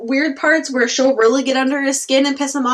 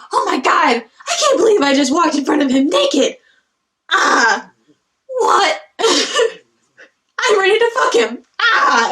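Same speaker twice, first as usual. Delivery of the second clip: mock excited girl; 5 semitones higher than usual. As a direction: gush like a flustered girl. Girl's inner voice, excited.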